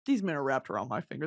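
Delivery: low, fast smug purr; smug mock boast. Bragging about the men at the premiere, a low smug boast.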